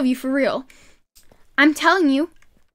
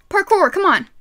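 normal talking voice

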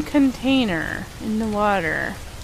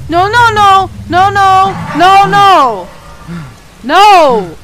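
loud, high, frantic repeated no; panicked screaming; 9 semitones higher, 9 dB louder than usual. Real, not acted. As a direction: scream it as everything goes wrong. Panics as danger hits in game.

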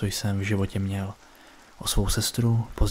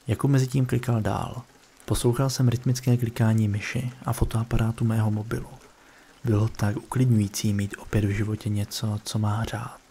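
ordinary delivery, nothing marked

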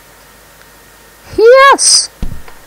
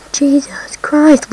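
whisper; 2 semitones higher, much slower than usual. Real, not acted. Awed whisper at level name.

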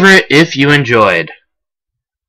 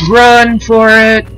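high, drawn-out yell of panic; panicked shout; 9 semitones higher, 3 dB louder, much slower than usual. Real, not acted. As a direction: scream it while running away. Fleeing again, shouted.

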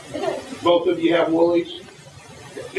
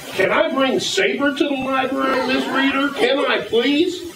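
put-on pleading child voice; 10 semitones higher than usual. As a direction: beg like a little kid asking permission. Story child begs the librarian.

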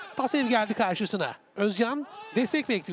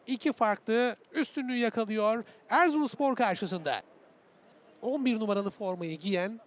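normal talking voice

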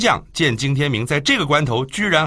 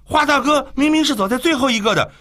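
higher, alarmed exclamation; startled cry. Acted. Two companions crying out in alarm, alarmed higher exclamation. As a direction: gasp it out in disbelief. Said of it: "齐声惊呼".

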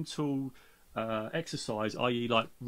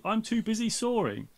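said high and firm, pressing the point; insist, too busy to stop; emphatic; 9 semitones higher, 4 dB louder than usual. A narrator's reading. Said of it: "the person says emphatically".

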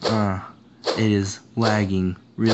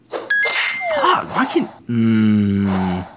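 frustrated outburst; 3 dB louder, much slower than usual. Real, not acted. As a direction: yelp then trail off. Gamer dies, cries out.

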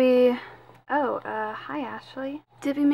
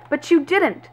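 louder and higher, accusing; 4 semitones higher, 6 dB louder than usual. Acted. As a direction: throw the blame in her face. Heated argument about lost children.

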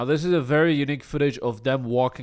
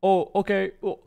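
put-on dazed old man; 9 semitones higher, 4 dB louder than usual. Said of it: "And he's like".

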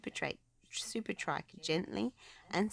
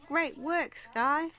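cheering; 10 semitones higher, 6 dB louder, much slower than usual. A narrator's reading. Pups cheering.

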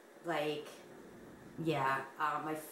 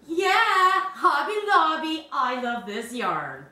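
gushing ad voice; 5 semitones higher, 4 dB louder, slower than usual. Pretend. Playfully echoing the yarn praise.

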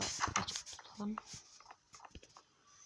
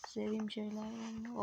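ordinary delivery

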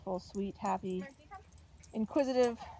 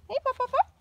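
higher, louder pup-pup call; puppy calling voice; 11 semitones higher, 8 dB louder, much faster than usual. Real, not acted. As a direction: chirp to call the pups. Calling puppies.

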